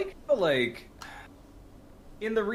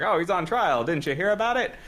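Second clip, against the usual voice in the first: put-on higher, louder old-timey movie voice; 1940s voice; 6 semitones higher, 4 dB louder than usual. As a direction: quote him like a 1940s film character. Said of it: "talk in your nineteen forties voice".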